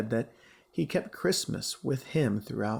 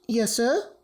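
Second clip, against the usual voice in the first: put-on higher, young maid answering; a serving girl, a bit higher; girl's voice; 8 semitones higher, 3 dB louder than usual. A narrator's reading. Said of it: "said the girl".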